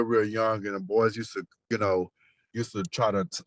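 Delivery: normal talking voice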